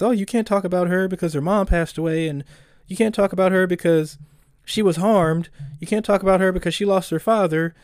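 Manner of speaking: high, whiny voice mocking the online crowd; mock whiny outrage. Mocking the internet's objections.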